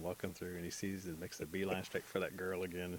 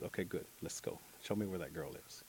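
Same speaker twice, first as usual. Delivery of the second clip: whispering; 3 semitones lower, 7 dB quieter than usual. Acted. Mole sneaking at night.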